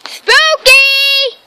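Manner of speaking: high, loud call to the dog. Real, not acted. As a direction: yell the dog's name across the yard. Calling her dog across yard.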